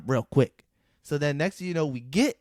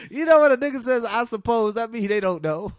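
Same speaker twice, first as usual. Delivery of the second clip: laughing; 8 semitones higher, 5 dB louder than usual. Real, not acted. Laughing explanation.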